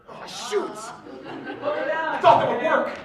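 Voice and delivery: usual speaking voice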